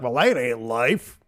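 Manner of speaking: slow, drawn-out Southern drawl; Southern accent. Acted. Average person dismissing alien life, mock Southern accent. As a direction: put on a hammed-up Southern accent. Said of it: "anybody who has a Southern accent".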